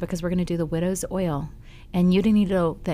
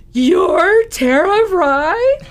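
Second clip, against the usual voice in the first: very high, loud, drawn-out disbelief; hammed-up astonished fan; starstruck shock; 13 semitones higher, 12 dB louder, much slower than usual. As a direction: squeal it slowly, rising into the question. Retelling boy's shock meeting his idol.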